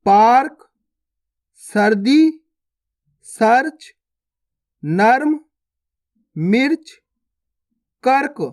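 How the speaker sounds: word-list drill, a clear pause after each word, mostly rising; direct word reading. Shown as a demonstration. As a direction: read each word whole as a separate item, lifting the end. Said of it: "direct पढ़ के देखेंगे".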